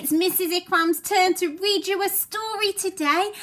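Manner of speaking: high, lively hello to the class; bright teacher greeting. Acted. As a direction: greet the children like a cheerful teacher.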